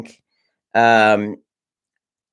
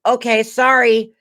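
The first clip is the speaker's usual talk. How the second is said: put-on high, slow kid's apology; apologetic kid; 10 semitones higher, 3 dB louder, slower than usual. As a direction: draw out a young auditioner's sorry, pitched way up. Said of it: "as, like, a twelve or thirteen-year-old kid".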